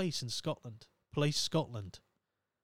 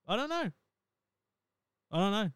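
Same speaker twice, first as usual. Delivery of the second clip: squeaky embarrassed; 7 semitones higher, faster than usual. Real, not acted.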